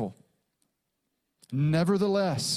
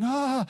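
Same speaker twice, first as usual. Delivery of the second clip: put-on child's delighted ah; 8 semitones higher than usual. Toddler thrown in the air.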